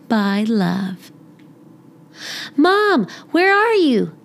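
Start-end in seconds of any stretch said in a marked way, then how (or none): 2.5-4.1 calling out. Acted. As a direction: call out for mom across the house. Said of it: "Amelia Bedelia yelled".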